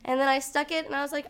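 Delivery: ordinary delivery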